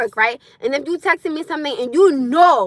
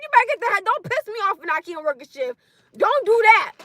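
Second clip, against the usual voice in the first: usual speaking voice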